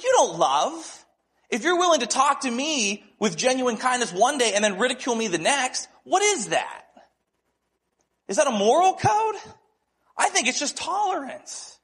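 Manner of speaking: high-pitched, incredulous challenge; retold indignant rebuke. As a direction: call the friend out with rising disbelief. Retold rebuke of his friend.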